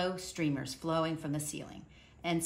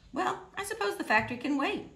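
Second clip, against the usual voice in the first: put-on lightly raised, higher storybook voice for the old man; a gentle lift into Mister Hatch's voice; 14 semitones higher than usual. Mister Hatch at the window, voiced higher and lighter.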